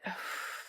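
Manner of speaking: long breathy sigh of release; relieved sigh. Real, not acted. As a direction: let the tension out with a sigh. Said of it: "Now release it".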